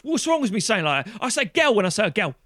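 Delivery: high, indignant mock London voice, "girl" as "gel"; defensive London accent. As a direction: protest in a broad put-on London accent. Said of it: "just say girl".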